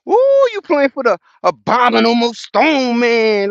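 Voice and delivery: put-on high, loud excited kid voice; quoting his son, hammed up and high; son's voice. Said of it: "My son said".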